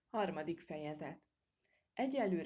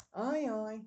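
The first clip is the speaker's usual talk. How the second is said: a waving-off ajaj, louder and higher; dismissive sigh; 5 semitones higher, 7 dB louder than usual. A narrator's reading. Old squirrel waving off the sly uncle, dismissive. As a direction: brush it off with a loud ajaj. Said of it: "legyintett Mókus apó".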